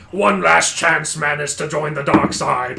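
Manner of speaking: slow, deliberate villain offer; villain's ultimatum. Acted. Villain walks forward to offer one last chance, slow ultimatum. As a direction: draw it out slowly as a final ultimatum. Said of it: "he walks forward and is like".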